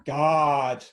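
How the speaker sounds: loud, put-on teenage exasperation; exasperated teenager. Host likens cat to a teenager, exasperated teen. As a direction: burst out like a fed-up teen. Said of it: "like your teenager where it's like".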